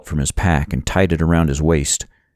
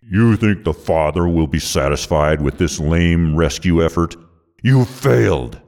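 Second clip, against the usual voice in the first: sneering. A narrator's reading. Villain mocking the hero's rescue attempt, sneering. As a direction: sneer lightly at a beaten opponent. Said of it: "Alexander sneered".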